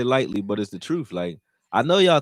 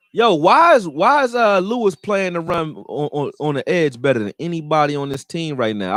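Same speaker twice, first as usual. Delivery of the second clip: excited; 6 semitones higher, 5 dB louder than usual. Real, not acted. Hyped about a tackle for loss.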